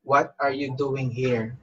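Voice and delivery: normal talking voice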